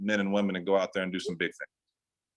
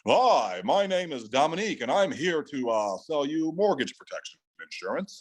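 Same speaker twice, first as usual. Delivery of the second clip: salesman voice; 4 semitones higher than usual. Acted. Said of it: "with your salesman voice on".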